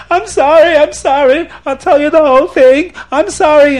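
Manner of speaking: high, loud panicked begging; hammed-up wailing apology; crying, screaming plea. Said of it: "he's crying and he's screaming".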